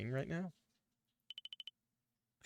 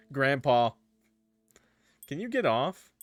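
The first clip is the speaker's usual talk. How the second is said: higher and louder yell; annoyed shout; 3 semitones higher, 6 dB louder than usual. Acted. Character yelling at the old man on top of her, loud protest. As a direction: shout at him to get off.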